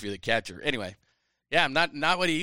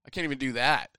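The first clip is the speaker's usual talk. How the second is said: ordinary delivery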